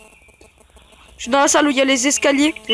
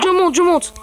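ordinary talk

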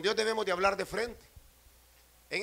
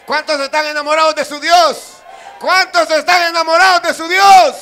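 shouted call to the crowd, climbing higher; full-force preaching; 6 semitones higher, 17 dB louder than usual. Acted. Preacher rallying those in love with God, shouted preaching. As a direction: yell the question out, bigger each time.

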